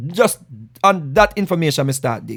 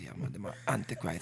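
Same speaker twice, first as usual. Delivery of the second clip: hushed unvoiced whisper; elephant voice; 9 semitones lower, 17 dB quieter than usual. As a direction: breathe the words out low. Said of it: "my elephant voice".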